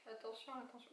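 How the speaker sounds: a quiet playful echo of the phrase; muttered aside. Real, not acted. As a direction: mutter the pun back to yourself. Muttered repeat of own wordplay.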